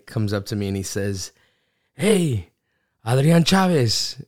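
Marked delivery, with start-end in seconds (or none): 2.0-4.2 mock call across the restroom, higher and louder. Stranger at the gas station calls out to him, a mock hail. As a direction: hail him like an old friend.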